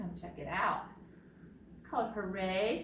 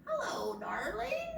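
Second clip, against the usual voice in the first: parrot voice; 10 semitones higher than usual. Shown as a demonstration. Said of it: "That's how parrots sound".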